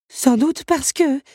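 high, slow, left hanging; excited guess cut short. A narrator's reading. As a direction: start to explain, then stop on a stretched last word. Teapot breaks off, said too much. (phrase aiguë laissée en suspens)

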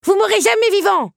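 put-on very high, loud child pirate; defiant retort. A narrator's reading. Little teacup duelling the beast, defiant retort. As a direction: fire back at full little-kid pitch. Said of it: "lui rétorqua son adversaire".

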